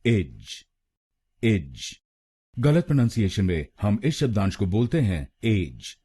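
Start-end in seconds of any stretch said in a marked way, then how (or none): 0.1-1.9 इज said as ij, not ej, low; teacher isolates इज; syllable drill. Shown as a demonstration. Teacher drills students on the last syllable, ij. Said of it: "हम बोलेंगे".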